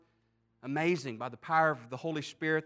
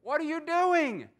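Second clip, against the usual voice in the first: high, loud startled protest; mock outrage at strangers tearing open his roof; indignant homeowner; 10 semitones higher, 3 dB louder than usual. Homeowner yells at men breaking his roof, high and indignant. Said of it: "you imagine someone might have looked up and said".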